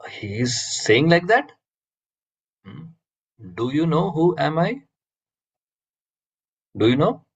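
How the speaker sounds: mildly indignant, pitch rising on the questions; agitated. Acted. Alexander reacts to being told to wait, mildly agitated. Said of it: "Alexander is now getting agitated".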